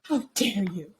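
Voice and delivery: whispered, scandalized; mock whispered outrage. Talking to co-host about a baby present, whispered mock outrage. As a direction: hiss it in pretend shock.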